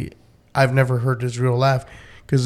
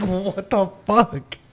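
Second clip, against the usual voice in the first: stunned; 5 semitones higher, 6 dB quieter, much slower than usual. Retold or imitated. Retold shock at laugh.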